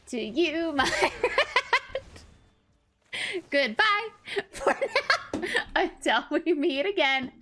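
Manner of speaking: loud, high theatrical goodbye, laughing; hammed-up farewell. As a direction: wave them off grandly. Mock farewell to removed card.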